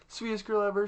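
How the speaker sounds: high-pitched mock-sweet girl voice; put-on sugary high voice; sweet girl act. Same words in mimicked girl voice.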